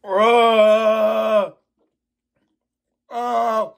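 high strained groans; groaning through the burn; pained groans. Real, not acted. Burning from pepper.

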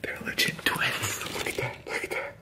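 hushed secret whisper to camera. Real, not acted. Telling the camera about the lookalike, whispered. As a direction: whisper so he won't hear.

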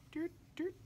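high, soft doot doot; playful doot sound. Pretend. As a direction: sing it out like the finger gliding along. Tracing the line, making a sound.

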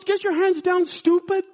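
high, loud mock shout; hammed-up exasperated order; angry scolding. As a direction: act the robber berating a clueless victim. Retold robber scolds him.

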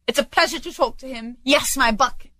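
high, chuffed exclamation; delighted Scrooge. Acted. Scrooge enjoys talking to the boy, delighted. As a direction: lift the pitch with pleasure.

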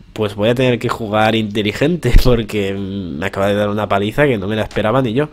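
normal talking voice

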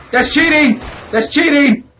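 high, loud complaint; indignant protest. Real, not acted. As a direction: yell out that it is unfair. Protesting two enemies.

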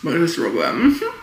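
talking while chewing; eating pizza mid-bite; mouth full. Real, not acted. Seen 0: Bites pizza, then chews with full mouth while talking.

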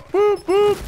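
squeaky high toy-horn doots; high doot-doot jingle. Acted. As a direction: toot it like a silly horn. Jingle after the chant.

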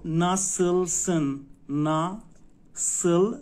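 slow, loud "ناسلسن" as na-sıl-sın; undotted ı. Shown as a demonstration. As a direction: break "ناسل" into long syllables with back unrounded ı. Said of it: "آی بدون نقطه".

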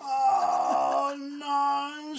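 high fed-up wail; exasperated dad. Acted. Sleepless dad at his wits' end, high wailing cry. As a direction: wail it like a worn-out father. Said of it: "said Dad".

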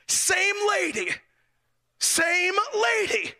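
high, slow, drawn-out "same lady"; exasperated emphasis. Retold or imitated. Preacher telling the congregation it was the same officer, high and slow.

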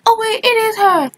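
high-pitched mock surprise; imagined sudden realization. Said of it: "they're gonna be like".

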